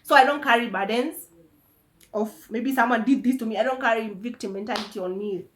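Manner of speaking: normal talking voice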